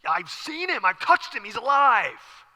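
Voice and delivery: acted joyful proclamation, stretched at the end; excited announcement. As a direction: burst out with astonishing news. Acted runner bringing the news, excited.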